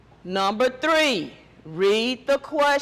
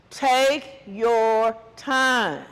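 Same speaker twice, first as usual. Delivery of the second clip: very slow, one word at a time; deliberate, spaced-out teacher's instruction; drawn-out, emphatic; much slower than usual. Real, not acted. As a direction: stretch out each word to tell students not to rush. Urging students not to rush.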